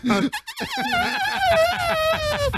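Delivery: very high, long trumpeting squeal; elephant sound. Shown as a demonstration. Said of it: "The little elephant sound?".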